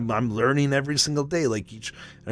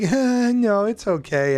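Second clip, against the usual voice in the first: much higher, louder, a put-on sweet girl; mocking girl voice; 9 semitones higher, 5 dB louder, slower than usual. Mimicking the girl politely brushing off the creepy guy, high and sweet. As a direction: squeak it out like a dismissive young woman.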